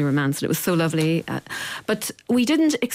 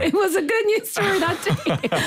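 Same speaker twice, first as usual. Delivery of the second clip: laughing; 13 semitones higher than usual. Real, not acted. Laughs at joke.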